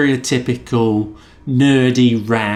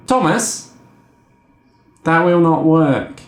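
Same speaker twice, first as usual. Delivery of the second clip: patient agent explaining no; mock agent voice; 3 semitones higher than usual. Agent final refusal.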